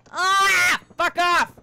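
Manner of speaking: loud, sharp angry outburst; angry yell. Real, not acted. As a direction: yell it at the screen. Enraged at game hazard.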